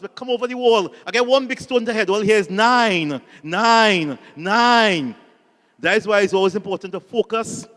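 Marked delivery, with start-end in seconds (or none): 2.5-5.2 much louder chanted count; gleeful shout. Retold or imitated. Crowd behind the wall cheers after hitting him with a stone, loud chant. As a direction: shout it in triumph as the stone lands.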